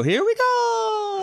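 very high, slow Mario cry. Acted. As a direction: squeal it like Mario jumping. Mario catchphrase at game start.

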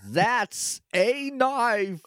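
high, very slow mock-Aussie "knife"; exaggerated Australian accent. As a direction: stretch out a hammed-up Australian "That's a knife". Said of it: "he's like".

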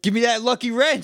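high, loud mock demand. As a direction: grab for it, loud and greedy. Imitating character demand.

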